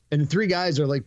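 ordinary delivery

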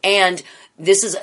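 usual speaking voice

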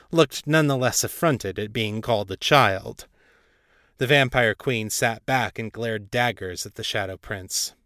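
ordinary delivery, nothing marked

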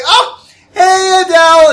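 very high, loud sing-song hello; Tiffany greeting. Acted. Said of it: "she's like".

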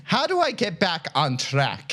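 hammed-up Irish question; pseudo-Irish accent. Podcaster finishing a listener's question, mock Irish. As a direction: ask it in a jokey Irish brogue. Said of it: "pseudo-Irish speech".

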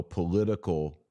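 ordinary delivery

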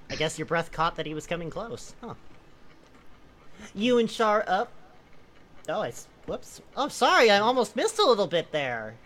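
usual speaking voice

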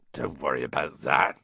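calm him down kindly; reassuring giant. A narrator's reading. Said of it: "said Hagrid".

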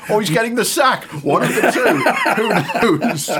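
laughing out loud through the words; high, loud, laughing at his own joke; bursting into laughter. Real, not acted.

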